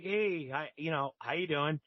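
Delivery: hushed, awkward hello; timid greeting. Retold or imitated. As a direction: imitate a meek newcomer saying hello. Timid greeting to staring gang leaders.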